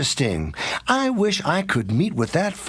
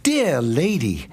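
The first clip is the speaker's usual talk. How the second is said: ordinary talk, nothing marked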